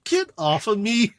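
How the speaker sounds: high, cross little voice; a small bot shrugging off a clingy pet; annoyed robot. Acted. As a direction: shove the words off, irritated. Aegis shaking off Scamper.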